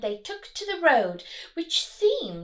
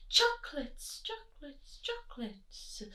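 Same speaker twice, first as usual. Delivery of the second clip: fading echo, each softer; echo from the cave; 4 semitones higher, 7 dB quieter than usual. Acted. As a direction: let it die away like an echo. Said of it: "a voice from the cave replied".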